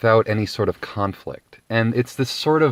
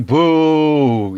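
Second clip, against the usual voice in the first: mock crowd boo; 5 semitones higher, 4 dB louder than usual. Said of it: "The Geodis shouting".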